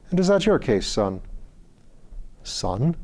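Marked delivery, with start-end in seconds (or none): 0.1-1.2 high, kindly Irish lord; Irish accent. Acted. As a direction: ask gently in an Irish lilt. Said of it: "He spoke with an Irish accent".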